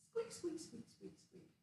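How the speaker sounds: high squeaks fading into a breathy hush; mouse squeaks. Acted. Teacher voicing the little piggy as a mouse. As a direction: squeak like a tiny mouse running off. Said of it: "that would be a mouse sound".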